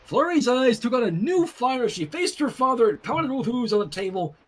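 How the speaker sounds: plain reading voice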